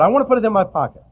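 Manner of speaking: put-on fast, excited child asking to keep it; little boy wanting. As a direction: lightly mimic a small boy grabbing for the bill. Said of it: "He said".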